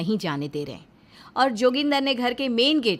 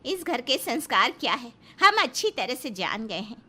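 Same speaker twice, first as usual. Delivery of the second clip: wife defying husband over the key, lightly voiced; defiant Kammu; 3 semitones higher than usual. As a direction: narrator voicing a defiant woman, somewhat higher. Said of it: "कम्मू ... कहती है".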